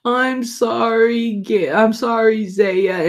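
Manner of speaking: high, slow mock-tearful little girl; crying child. Granddaughter apologizes to her little brother, imitated crying child. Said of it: "She was crying".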